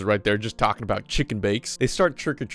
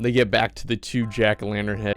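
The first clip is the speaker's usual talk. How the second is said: ordinary talk, nothing marked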